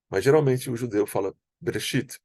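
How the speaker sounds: ordinary delivery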